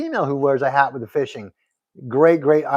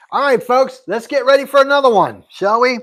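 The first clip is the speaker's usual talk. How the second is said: pitched up and louder, rallying the viewers; hyped announcer call; 7 semitones higher, 7 dB louder than usual. Real, not acted. Host rallying stream viewers before the next gift, in a hyped-up call. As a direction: rev up the chat like a game show host.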